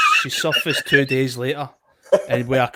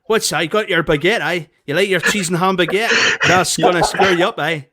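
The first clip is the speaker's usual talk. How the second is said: put-on a light Belfast accent, higher and a bit louder, ribbing; Belfast man impression; 4 semitones higher, 5 dB louder than usual. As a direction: lightly mimic the Belfast guy teasing about the baguette. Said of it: "he's going".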